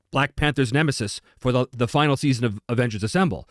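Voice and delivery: ordinary delivery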